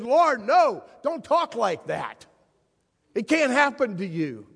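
Peter cuts in loud and high, refusing; loud protest. Acted. Peter bursting in to stop Jesus talking of death, loud protest. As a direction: blurt out a frantic objection.

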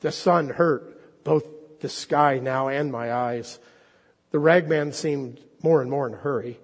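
expressive storyteller read aloud; story reading. Acted. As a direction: draw the congregation into the tale. Preacher reads The Rag Man aloud.

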